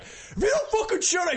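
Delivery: high, yelled threat; angry shout. Retold or imitated. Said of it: "he was like".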